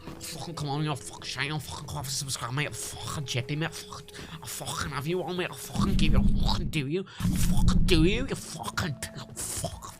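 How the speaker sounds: rapid-fire mock-threatening Scouse accent, throaty k sounds; modern Liverpool accent. Shown as a demonstration. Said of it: "I'll do the fucking modern Liverpool one".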